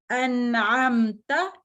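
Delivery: अनअमता slow with a light easy ता. Shown as a demonstration. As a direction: stretch अनअमता, keep the ता light. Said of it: "ता बहुत light".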